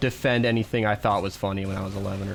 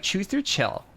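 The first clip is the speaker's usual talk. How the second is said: catty person; 9 semitones higher, slower than usual. Retold or imitated. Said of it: "a catty person would say".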